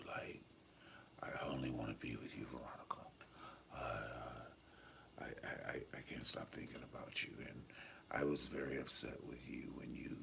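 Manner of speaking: low, unvoiced whisper of a brooding man; David's whisper, low and hushed; grunt whisper. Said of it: "David's always whispering".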